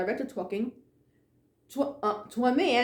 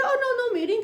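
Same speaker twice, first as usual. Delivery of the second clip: higher, breezy "oh, no"; surprised denial; 14 semitones higher, much faster than usual. Retold or imitated. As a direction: toss it off lightly, surprised she asks. Emily brushing off question.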